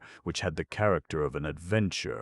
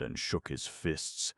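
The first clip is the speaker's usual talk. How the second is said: ordinary delivery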